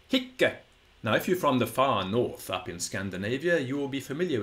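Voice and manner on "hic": hic with a held double c and short i; doubled final consonant. Shown as a demonstration. Latin teacher showing how hic was pronounced, doubled final consonant. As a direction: say hic as "hikk-uh", high and crisp. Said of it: "have to pronounce it this way".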